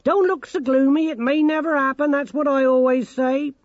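loud, high-pitched forced cheer; old granny's booming jollity; Granny Morky. A narrator's reading. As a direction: call it out high and hearty, trying to be friendly. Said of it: "trying to be friendly".

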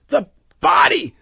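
high, loud frantic scream. A narrator's reading. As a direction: scream past the crowd. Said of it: "Loyce screamed".

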